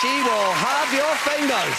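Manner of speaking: high, slow mock warning; drunken warning. Drunk man warning his mate, high and drawn out. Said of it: "Drunkenly, I went".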